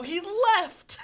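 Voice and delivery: high, loud put-on wail; mock-hysterical outburst. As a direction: blurt it out as a fake hysteric. Hysterical son answers his dad.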